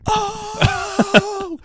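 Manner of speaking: long, high-pitched hoot; high mock crowd "oh!". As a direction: imitate boys erupting at a win. Retold boys' crowd reaction.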